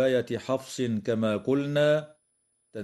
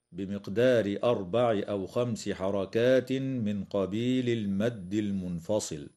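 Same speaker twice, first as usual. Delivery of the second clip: ordinary delivery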